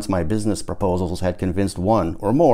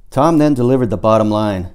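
plain reading voice

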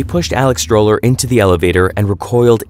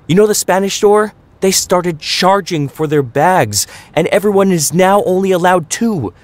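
climbing higher and higher, fed-up tirade; raging rant; 9 semitones higher than usual. A narrator's reading. Mama venting to Papa at dinner prep, high climbing angry rant. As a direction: rant like every word is a knife whack. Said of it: "Mama raged at Papa".

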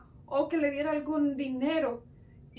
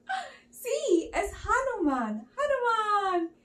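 high, gasping, acted excitement; delighted recognition; 9 semitones higher, slower than usual. Storyteller reveals Hanuman to children, thrilled. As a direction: gasp and greet a hero gleefully.

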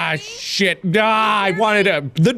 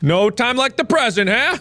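normal talking voice

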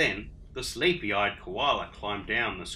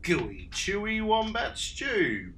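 a touch of boastful, slow and higher; boasting; 7 semitones higher, slower than usual. Acted. Dingo boasts to Emu about his stew. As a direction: show off the stew. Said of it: "boasted Dingo".

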